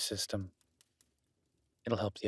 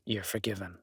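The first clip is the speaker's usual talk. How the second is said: ordinary talk, nothing marked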